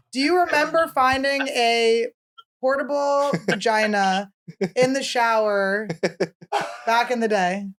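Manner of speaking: slow, spaced-out question; measured phrases with pauses, like questioning a witness; deliberate questioning. Real, not acted. Asking dad about the shower, slow and deliberate. Mock-formal question to father.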